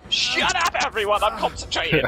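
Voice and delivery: shouting. Real, not acted. Yells at friends during skill checks.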